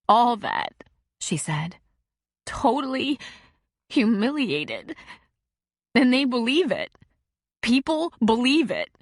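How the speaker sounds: a hint of a hurt teen pouring it out; a distraught girl describes the leaked picture to a counselor; upset teenage girl. A narrator's reading. Teen girl voiced in story.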